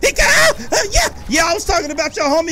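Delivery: high, loud, fast trash talk; taunting shout. Real, not acted. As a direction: goad the enemies into a fight. Taunting the game's enemies.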